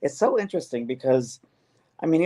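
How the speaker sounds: ordinary delivery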